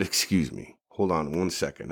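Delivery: low, hushed aside; softly excusing himself; quiet excuse. Retold or imitated. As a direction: murmur it politely while slipping away. Stepping away mid-signing.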